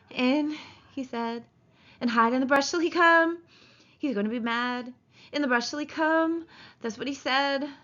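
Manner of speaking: a hint of childlike panic; frightened Lenny. Lenny frightened, recalling George.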